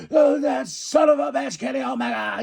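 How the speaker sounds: high, loud mock rant at Kenny Omega; imitated furious shout. Don Callis cursing Kenny Omega on the ramp, high loud shout. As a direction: yell it like a wrestling manager cursing his rival. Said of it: "Don Callis is like".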